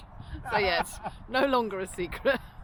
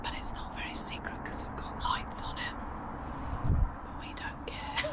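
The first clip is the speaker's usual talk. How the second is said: hushed, playful whisper; voiceless, confidential whisper; secretive whisper; 8 dB quieter than usual. Pretend. Whispering about the secret bench.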